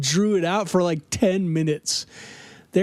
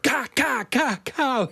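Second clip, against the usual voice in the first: gunshot noises; 5 semitones higher than usual. Retold or imitated. Teammates firing pistols at the gang, mouth gunfire. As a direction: mouth gunfire, a bit higher. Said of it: "pointing them straight at him".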